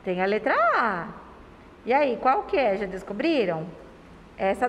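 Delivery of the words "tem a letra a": ordinary talk, nothing marked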